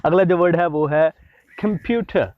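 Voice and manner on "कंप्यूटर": कंप्यूटर with no final r, ending on a schwa; British computer. Shown as a demonstration. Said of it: "यहाँ पर आर नहीं pronounce किया जाता।". (कंप्यूटर बिना आर के, आख़िर में अ की आवाज़)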